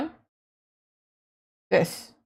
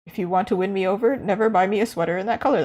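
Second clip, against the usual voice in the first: ordinary talk, nothing marked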